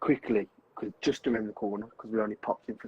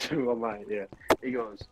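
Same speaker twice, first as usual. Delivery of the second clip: ordinary talk, nothing marked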